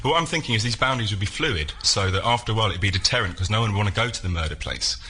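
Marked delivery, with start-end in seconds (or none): none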